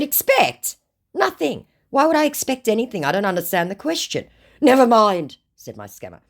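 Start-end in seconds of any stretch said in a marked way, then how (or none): 0.0-4.3 high, quick mock indignation; mock baffled. Acts her own baffled reply. 4.6-5.4 high, loud mock scammer; scammer's put-on voice. Acts out scammer's reply.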